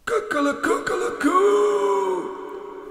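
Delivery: high, drawn-out cockerel crow; rooster crow. A narrator's reading. Young cockerels crow at the dying sun, high and long. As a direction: stretch the crow out high and slow. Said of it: "they would crow".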